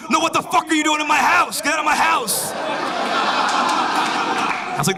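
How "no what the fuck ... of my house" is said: high-pitched yelling in outrage; freaked-out man. Retold or imitated. Homeowner finding an intruder in his house, high outraged shout. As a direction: yell like a panicked, furious homeowner. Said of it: "And he freaked out".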